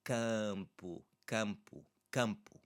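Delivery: "campo" with a nasal a before m; nasal a demo. Shown as a demonstration. Teacher repeating "campo" for students to copy. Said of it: "let's hear it in the word".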